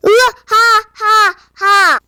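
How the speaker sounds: slow, booming evil laugh; booming giant laugh. Acted. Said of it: "껄껄 웃으며 말했어요".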